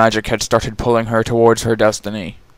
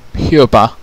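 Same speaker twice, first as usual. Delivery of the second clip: louder, firm name call; stern call; 6 dB louder than usual. Acted. Queen calling her youngest daughter, firm. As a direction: snap her to attention.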